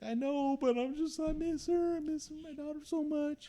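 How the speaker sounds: high, loud tearful whine; crying plea. Retold or imitated. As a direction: beg through tears, voice cracking high. Retelling crying to sergeant.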